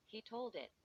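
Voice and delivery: plain reading voice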